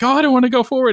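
high-pitched scared donkey imitation; mock terrified. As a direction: play at being scared, voice jumping up high. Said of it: "the donkey's terrified".

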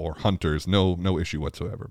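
normal talking voice

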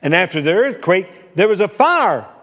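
loud, rising to a high peak; booming preaching. A narrator's reading. Preacher telling the congregation of the fire, booming. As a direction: build to a shouted climax on the fire.

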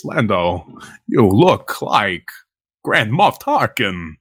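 slow, low Walken drawl; lightly voiced Walken impression; Christopher Walken. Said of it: "Christopher Walken gets it. He says".

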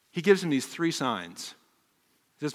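normal talking voice

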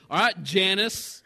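loud, drawn-out mock confrontation; retold huffy challenge; upset. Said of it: "so I'm a little upset".

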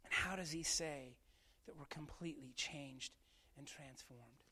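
soft, hushed sermon voice; hushed preaching. Real, not acted. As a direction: draw them in low and quiet. Quiet closing teaching.

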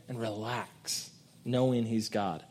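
calm, tender relax; soft reassurance. Real, not acted. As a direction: let the words settle gently.

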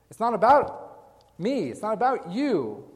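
slow, high and loud "me" and "you"; emphatic. Shown as a demonstration. Preacher stressing the church's mission, slow and emphatic. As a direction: hammer each word slowly. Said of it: "preacher's key point".

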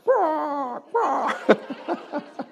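high, drawn-out eagle cries; eagle screech. Shown as a demonstration. Preacher demonstrating the eagle noise for congregation, screeching. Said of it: "There's the eagle noise".

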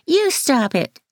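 high mocking effeminate voice; mock the boy in a camp high voice; teasing camp imitation; effeminate mimicking. A narrator's reading. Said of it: "In an effeminate voice".

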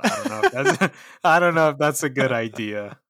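laughing through the words; amused giggle while doubting; real laughter breaking the words. Real, not acted. Laughs at piss people off.

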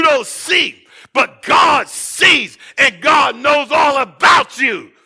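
a pastor crying out to the church, in full preaching force. Acted. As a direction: thunder it out as the Spirit moves. Preaching peak, Holy Ghost.

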